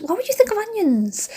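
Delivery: high-pitched self-scolding wail; mock exasperation. Telling herself off while trying not to cry, high mock exasperation. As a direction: shoot up high in playful disbelief.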